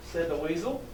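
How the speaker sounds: ordinary delivery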